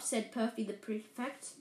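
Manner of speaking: usual speaking voice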